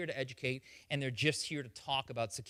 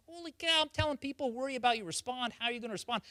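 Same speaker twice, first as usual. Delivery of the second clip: excited realization; 8 semitones higher, faster than usual. Retold or imitated. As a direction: pitch jumps high, eager and amazed. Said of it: "I'm like".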